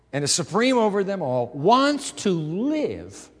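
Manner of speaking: loud, rising preacher's build; impassioned preaching. Acted. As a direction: pastor urging the congregation about God moving in, loud fervent preaching. Preaching at full force.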